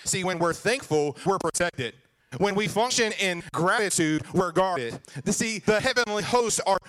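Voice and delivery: preaching at full force. Acted. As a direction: lift the church with the promise. Preaching climax.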